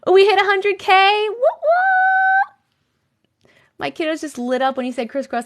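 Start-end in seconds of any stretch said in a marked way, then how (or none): 0.1-3.5 high, loud whooping cheer; celebrating. Real, not acted. Streamer cheering her viewers for hitting a hundred K. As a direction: whoop with real joy at the milestone.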